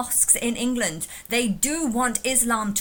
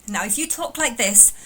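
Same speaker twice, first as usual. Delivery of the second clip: normal talking voice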